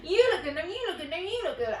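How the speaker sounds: high, singsong volley of compliments; gushing girlfriends. Retold or imitated. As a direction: mimic friends outdoing each other's compliments. All of us going.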